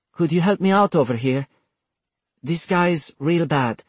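worn out, asking for help; exhausted voice. Acted. Vincent, drained, asks Taylor for help with a mild tired plea. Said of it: "already sounding exhausted".

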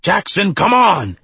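high, loud urgent shout; yelling. A narrator's reading. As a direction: yell after someone to hurry. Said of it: "the scarred one yelled".

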